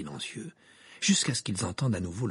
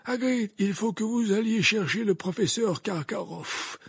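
put-on high, measured, commanding old wizard; urgent Dumbledore; 13 semitones higher, 4 dB louder than usual. Dumbledore sends Hagrid for help, urgent. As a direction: send them off with urgency.

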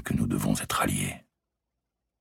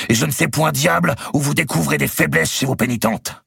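much higher, louder angry scolding; shouting; 4 dB louder than usual. Acted. Robert scolding the chaplain, loud angry shout. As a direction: rage at the fool. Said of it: "lui cria-t-il".

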